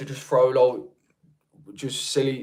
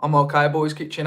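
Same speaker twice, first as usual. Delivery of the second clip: ordinary talk, nothing marked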